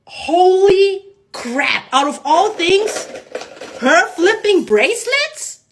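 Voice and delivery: high, loud, drawn-out astonishment; retold amazement. Amazed that of all things her bracelets moved, reenacted astonishment. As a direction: stretch each phrase in reenacted disbelief.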